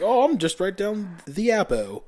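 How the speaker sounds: high, drawn-out mock Southern talk; mocking imitation. Coworkers making fun of his phone act, mocking Southern imitation. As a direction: mimic his put-on accent slowly, pitched high. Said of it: "mocking me the whole time".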